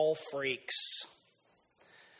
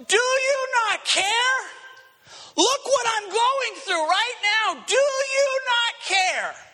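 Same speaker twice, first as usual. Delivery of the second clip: much higher, slow desperate cry; anguished cry to God; 12 semitones higher, slower than usual. Retold or imitated. Believer in a storm crying to God, high desperate plea. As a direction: plead with heaven, voice stretched high. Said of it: "we're going".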